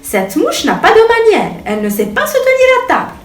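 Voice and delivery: high, fast, scandalized; indignant high voice. A narrator's reading. The bee gossips to the butterfly, high and shocked. Bee complains about the fly.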